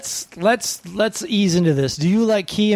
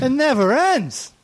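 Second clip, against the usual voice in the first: high, slow Yiddish kvetch; Yiddish accent; 8 semitones higher, slower than usual. Acted.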